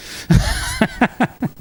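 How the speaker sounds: high chuckle in quick bursts; real laugh, pitched high; amused laugh. Real, not acted. Mocking the psychologist in his mind, high laugh.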